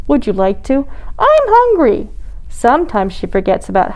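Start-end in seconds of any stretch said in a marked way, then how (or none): 1.2-2.1 very high, demanding little penguin; interrupting. A narrator's reading. Polly interrupts another penguin, high and demanding. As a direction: cut in with a high-pitched demand. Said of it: "Sometimes Polly interrupts".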